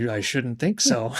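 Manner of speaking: half-laugh at an odd question; mock uneasy chuckle; awkward laughing answer. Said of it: "He's like".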